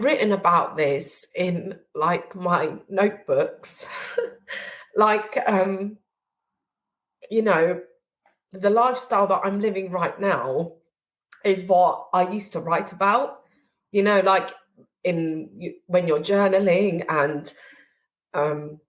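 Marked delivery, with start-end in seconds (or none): none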